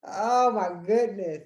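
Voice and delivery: loud, high dismayed "oh Lord" with a laugh; dismayed exclaim. Retold or imitated. Daughter reacting to her stepdad's missing gift, loud and dismayed. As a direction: groan it out in mock despair. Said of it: "I was like".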